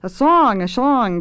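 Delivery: shrill cry of demand; shrill chorus. A narrator's reading. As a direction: pipe up like eager underlings. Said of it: "came the shrill chorus".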